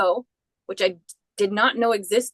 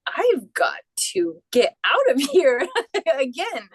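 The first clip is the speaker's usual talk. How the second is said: laughing escape urge; 4 semitones higher than usual. Retold or imitated. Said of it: "I was like".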